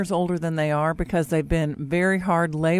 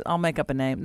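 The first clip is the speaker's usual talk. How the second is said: ordinary delivery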